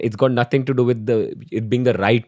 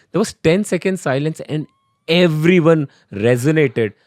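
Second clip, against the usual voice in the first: ordinary talk, nothing marked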